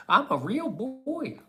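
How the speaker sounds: put-on high, slow little-boy squeak; hammed-up Pinocchio squeak; squeaky puppet voice. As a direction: play a puppet insisting he's real. Silly voice, Pinocchio line.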